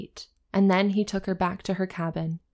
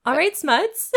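high, loud upbeat sign-off; cheery outro; 10 semitones higher, 6 dB louder than usual. Real, not acted. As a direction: sign off with big energy.

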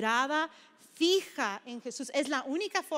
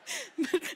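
a quick high laugh at her own story; brief amused laugh; short laugh; 8 semitones higher than usual. Real, not acted.

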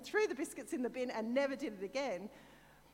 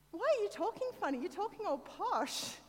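high-pitched mock bewilderment; puzzled sister's voice, pitched well up; imitating teasing sister; 9 semitones higher than usual. Sister teasing her in the car about her speech, mock bewilderment.

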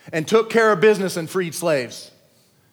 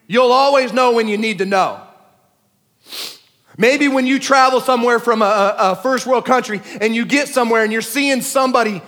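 louder, higher, driving sermon voice; preaching at full force; 4 semitones higher, 5 dB louder than usual. Acted. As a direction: preach it hard to the congregation.

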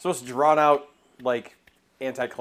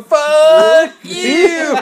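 loud laughing yell; 14 semitones higher, 15 dB louder than usual. Retold or imitated. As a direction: shout it across the yard. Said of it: "in my backyard going".

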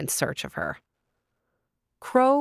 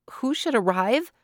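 usual speaking voice

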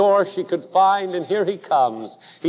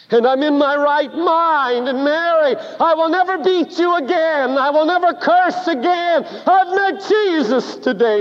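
fervent vow; 11 semitones higher than usual. Acted. Husband vows to wife.

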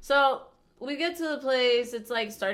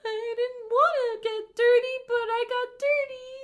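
high, drawn-out mock wail; imitating crying; 13 semitones higher, 4 dB louder than usual. Upset kid explaining he got dirty, crying. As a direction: whine it like a bawling kid. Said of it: "Matthew was, like, crying".

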